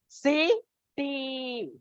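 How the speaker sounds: CITY split in two, final EE held long; drawn-out vowel sound. Shown as a demonstration. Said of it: "The E sound is there".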